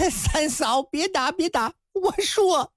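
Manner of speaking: high, panicky laughing plea; frightened stammer. A narrator's reading. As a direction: beg in a fluster, voice jumping up. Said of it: "高怀德吓得". (吓得慌张求饶)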